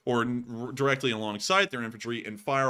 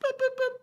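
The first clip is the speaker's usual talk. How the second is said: much higher beeping like phone buttons; phone dial beeps. Acted. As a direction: beep out the dialing tones. Dialing nine one one.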